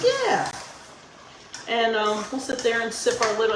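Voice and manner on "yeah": eager yes. Retold or imitated. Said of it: "I'm like".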